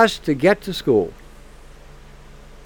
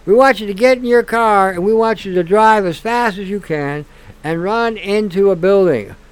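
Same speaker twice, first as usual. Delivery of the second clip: ordinary talk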